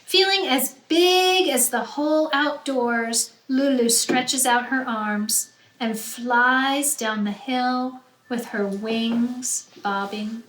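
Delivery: ordinary delivery, nothing marked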